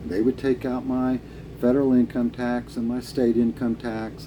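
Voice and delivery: ordinary talk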